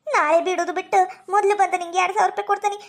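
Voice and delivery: normal talking voice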